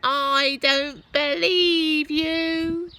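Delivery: put-on high, loud, slow wailing old woman; grandma crying out from the roof; Grandma answering. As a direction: wail your disbelief. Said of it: "she answered".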